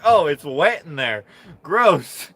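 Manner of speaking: shudder at the wet hand; mock grossed out. Classmate feeling his wet hand, mock grossed out.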